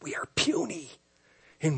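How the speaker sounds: soft whispered awe; hushed whisper. Acted. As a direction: breathe it out like a confession. Dramatic hushed opening line.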